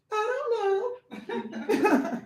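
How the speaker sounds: high, very slow, drawn-out "I don't know" with a laugh; whiny mock shrug. Shown as a demonstration. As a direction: stretch "don't" out high like a clueless excuse. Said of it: "Oh, I gotta say it like".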